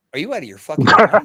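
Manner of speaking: fast, loud, incredulous yell; retold outburst. Wrestler snapping at a rival in the ring, loud and fast. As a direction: blast it back at him, fast and loud. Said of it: "And Doug goes".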